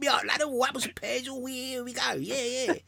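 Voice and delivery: high-pitched cockney gabble; East End cockney. Shown as a demonstration. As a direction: swing into an exaggerated East End accent. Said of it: "I can swing back and forth between".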